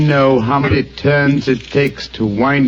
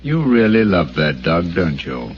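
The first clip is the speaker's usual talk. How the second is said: tender; 4 semitones lower, 4 dB quieter than usual. Acted. Holmes speaking softly to Gilly.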